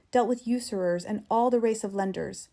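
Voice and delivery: ordinary delivery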